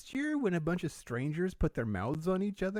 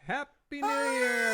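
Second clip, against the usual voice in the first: shouted high and slow; new year countdown crowd; 13 semitones higher, 3 dB louder, much slower than usual. Real, not acted. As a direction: yell it out with joy. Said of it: "Worst audio decision for a podcast".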